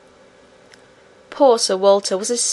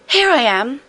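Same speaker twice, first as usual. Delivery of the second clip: excited call; 4 semitones higher than usual. A narrator's reading. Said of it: "the princess cried".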